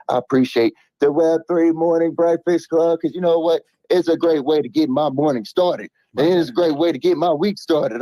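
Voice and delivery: high, excited radio host; hyped host voice. Acted. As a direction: pump up the crowd like a morning show host. Hyped announcer-style shout-out.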